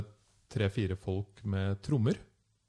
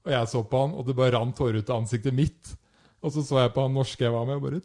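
normal talking voice

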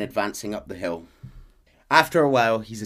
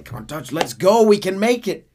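higher, louder coaxing; eager urging; 12 semitones higher, 11 dB louder than usual. Retold or imitated. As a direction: plead with Dutch eagerly. Micah urging again.

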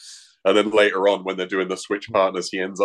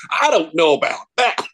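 imitating Pee-wee; 9 semitones higher, 4 dB louder than usual.

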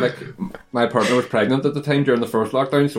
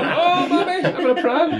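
put-on high squeaky baby voice. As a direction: squeak it like a toddler in a pram. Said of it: "Flying down Fountain Hill".

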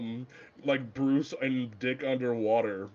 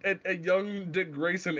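ordinary talk

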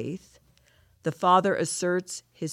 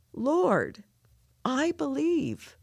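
tearful, slow and higher; real crying; 8 semitones higher, much slower than usual. Real, not acted. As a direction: choke the words out through real tears. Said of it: "So I'm crying, and, and those tears are real".

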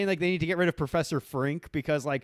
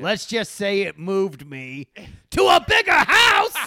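Krusty the Clown; 2 semitones higher, 8 dB louder, slower than usual. Retold or imitated. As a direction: quote Krusty, ending in a cartoon shout. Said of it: "He's like that Krusty the Clown is like".

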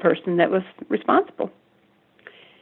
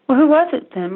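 higher, louder insistent question; demanding; 8 semitones higher, 6 dB louder than usual. Retold or imitated. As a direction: press him hard for the name. Mother demanding name from son.

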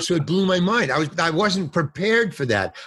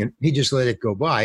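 ordinary talk, nothing marked

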